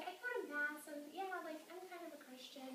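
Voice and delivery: half-shrug it like she's unsure; a hint of a hesitant college girl; shy sorority girl. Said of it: "she's like".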